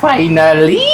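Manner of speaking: ordinary talk, nothing marked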